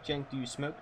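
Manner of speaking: ordinary talk, nothing marked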